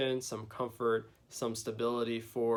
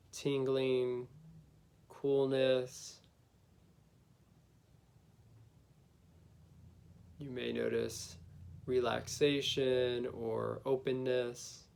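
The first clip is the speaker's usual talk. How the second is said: guided meditation. Acted. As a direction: gently lead them inward, unhurried.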